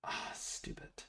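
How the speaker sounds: slow whispered annoyed aside under the breath; whispered grumble. Real, not acted. Streamer to himself when Siri won't answer, whispered grumble. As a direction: hiss it to yourself in frustration.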